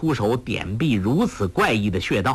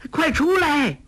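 much higher urgent call. A narrator's reading. 黄蓉 calls the others out of the room, urgent. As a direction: yell for them to come out. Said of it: "叫道".

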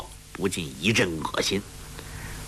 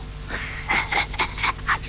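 hushed wheezing laugh under the breath; a conspiratorial old man's snicker; 压低声音偷笑; sly breathy chuckle; 4 dB quieter than usual. A narrator's reading. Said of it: "洪七公笑道".

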